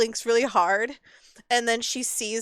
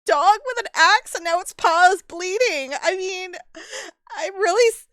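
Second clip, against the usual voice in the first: high, near-tears complaint; fighting back tears over her dog; upset owner; 10 semitones higher, 5 dB louder than usual. Acted. Lucy telling the players their friend hurt her dog, near tears.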